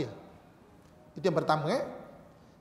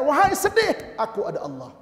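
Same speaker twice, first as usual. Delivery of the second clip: 1 bold declaration; 8 semitones higher, 7 dB louder than usual. Shown as a demonstration. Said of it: "Tapi katakan".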